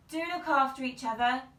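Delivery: loud parting call. A narrator's reading. Mother calling after the pair, loud call. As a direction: call after them down the road. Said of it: "called Sally's mother".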